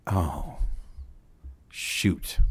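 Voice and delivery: low, soft, deflated "oh, shoot"; retold dismay. Treatment provider hearing of today's drug use, quietly dismayed. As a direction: let it sink out in a hushed, spaced-out aside.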